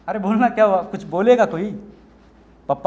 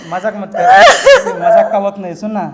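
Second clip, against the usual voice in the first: panicked pleading; 13 dB louder, slower than usual. Acted. Panicked boyfriend hears pregnancy news.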